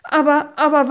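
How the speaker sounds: loud, high, hurried protest; objecting. A narrator's reading. Moses objecting to God's command, protesting. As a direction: cut in with an urgent objection. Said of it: "wandte Mose ein".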